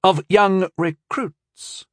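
slow, measured correction; steady, unhurried correction; even correction. A narrator's reading. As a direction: set him straight calmly. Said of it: "corrected Walvin evenly".

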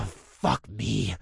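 low, resigned sigh through the words. Acted. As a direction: let it out on a weary sigh. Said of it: "I sighed".

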